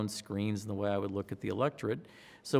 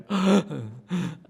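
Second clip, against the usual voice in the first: high startled intake of breath; sharp gasp; 7 semitones higher, 9 dB louder than usual. Retold or imitated. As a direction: gasp in shock for air. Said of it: "I'm gasping for air".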